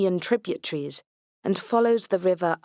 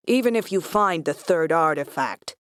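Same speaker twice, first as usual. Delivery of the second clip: harsh American drawl; defiant, harsh American drawl; 2 semitones lower than usual. A narrator's reading. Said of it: "His voice is a harsh American drawl".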